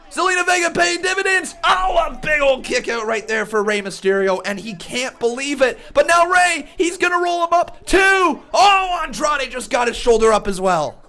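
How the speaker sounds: high, loud rapid-fire calling; live play-by-play. Real, not acted. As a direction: shout every move as it happens.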